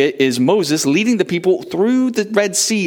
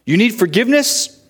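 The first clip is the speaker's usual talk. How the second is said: asking listener; 7 semitones higher than usual. Pretend. Call and answer refrain.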